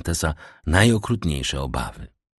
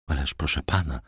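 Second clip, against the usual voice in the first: plain reading voice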